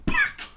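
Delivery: squeaky high cough-word; coughing out Porto. Acted. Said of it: "a tossir".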